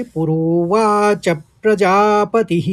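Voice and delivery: "पुरोवाच प्रजापतिः" slowly, stressing पौ as "पुरोवाच प्र..."; slow, carefully chanted पुरोवाच प्रजापतिः; demo pronunciation. Shown as a demonstration. Teacher demonstrates second quarter, slow and careful. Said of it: "इस तरह से उच्चारित करेंगे".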